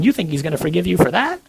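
incredulous inner accuser, climbing high; mock-incredulous tempter, pitch rising sharply at the end; accusing little voice. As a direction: taunt them with a scornful question. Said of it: "this little voice is over here saying".

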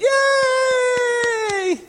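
very high, shouted cheer; mock cheering crowd. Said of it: "all the nuns went".

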